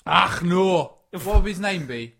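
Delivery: broad, emphatic Scottish builder; Scottish accent. Acted. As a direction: put on a hearty Scottish accent. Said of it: "But he's Scottish".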